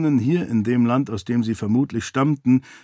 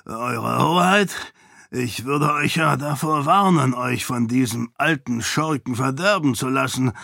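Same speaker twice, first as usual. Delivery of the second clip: usual speaking voice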